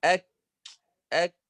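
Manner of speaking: أك with a strong stop and puff of air; letter demo. Shown as a demonstration. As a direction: pop the ك then release breath on أك. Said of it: "هذا الصوت هو همس وفيه قوة". (نطق الكاف الساكنة بشدة وهمس)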